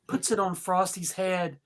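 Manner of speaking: normal talking voice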